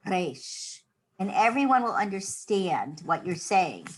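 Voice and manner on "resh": resh with a trilled, rolled R. Shown as a demonstration. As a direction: roll the r in resh, softly. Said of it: "you can kind of roll the resh".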